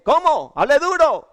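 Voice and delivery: high, loud, in a different put-on voice; demanding shout. Santander waitress ordering the timid customer to speak up, loud and brusque. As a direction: bark at him to speak up like the waitress.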